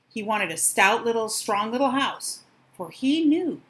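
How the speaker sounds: ordinary delivery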